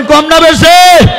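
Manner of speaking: rousing the congregation to answer; loud slow call. Real, not acted. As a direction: push the crowd to shout back. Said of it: "জোরে বলেন".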